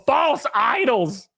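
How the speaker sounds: stretched out slowly, weighty; slow dramatic catchphrase. Acted. Streamer branding his chat with a slow mock-preachy catchphrase. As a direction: lay it down slowly like a sermon.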